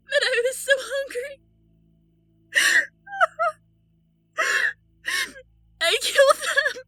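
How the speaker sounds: high, cracking sob-laugh; sobbing breakdown. Acted. Breaks down admitting killing.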